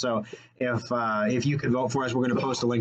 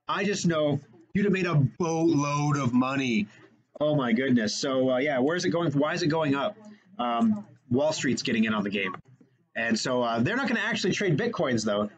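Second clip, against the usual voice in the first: ordinary delivery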